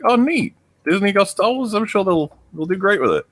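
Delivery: high, sarcastic mock cheer; sarcastic optimism. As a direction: sound falsely hopeful about Disney. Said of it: "everyone was like".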